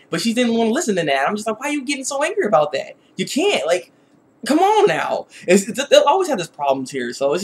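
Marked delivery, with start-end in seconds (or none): 1.6-5.3 mildly exasperated, pitched higher; higher-pitched, pleading reproach. Acted. Viewer scolding Korra for getting so angry, mildly exasperated. Reacting to Korra's stubborn anger.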